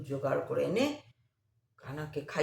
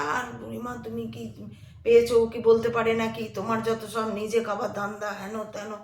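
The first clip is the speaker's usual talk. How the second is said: angry accusation; 6 semitones higher, 6 dB louder than usual. Retold or imitated. Man angrily accuses old woman.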